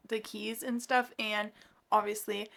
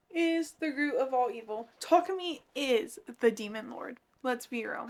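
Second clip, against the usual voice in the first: normal talking voice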